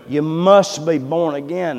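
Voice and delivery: insistent, ringing echo in the head; haunting refrain. Retold or imitated. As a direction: press the refrain home harder. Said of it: "all I could hear was".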